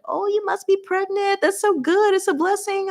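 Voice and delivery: high, gushing put-on church voice; mock gushing churchgoer. Churchgoers guessing she is pregnant, high gushing mock voice. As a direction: coo it like a nosy church lady.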